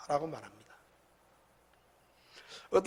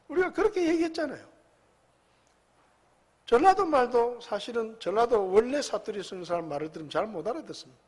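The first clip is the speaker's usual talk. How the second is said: usual speaking voice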